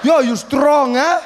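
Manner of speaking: put-on high, loud laughing drunk yell; drunk uncle shouting. Drunk uncle yelling at his albino nephew, laughing loud. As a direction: bellow it like a merry drunk uncle. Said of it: "he's like".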